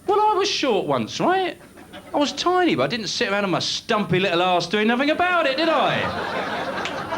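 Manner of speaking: high, reedy put-on voice all through; squeaky comic voice. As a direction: keep it up high like a little whiner.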